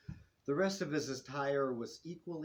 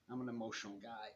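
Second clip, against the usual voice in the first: ordinary delivery, nothing marked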